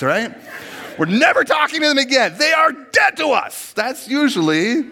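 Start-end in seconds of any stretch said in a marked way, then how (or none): 1.0-3.7 mock furious shout. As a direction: yell it like you're storming out for good. Ranting to spouse, shouting.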